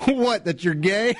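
laughs through the line, slowly; can't hold back the laughter; laughing. Real, not acted. Laughing while reading line.

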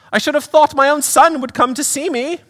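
very high, affronted British lady; Pam. Acted. Mother hurt her son stayed away, high British accent. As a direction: complain in a high British woman's voice. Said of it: "do a little accent work".

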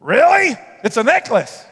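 incredulous exclamation to the congregation. Real, not acted. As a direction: throw the word back in disbelief. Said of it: "pastor's incredulous reaction".

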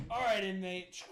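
gruff guard. Acted. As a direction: bark it at the prisoner like a jailer. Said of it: "they just like".

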